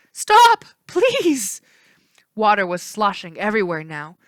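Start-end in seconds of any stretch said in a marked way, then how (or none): 0.1-1.6 high, loud panicked horse cry; desperate high horse wail. A narrator's reading. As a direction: shriek it like a terrified horse. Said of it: "a horse cried".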